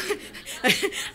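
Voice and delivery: airy unvoiced laugh; breathy giggle. Real, not acted. Keeps laughing.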